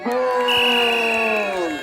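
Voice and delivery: long high stretched goal cry; goal scream. Real, not acted. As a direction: shout the goal and hold it.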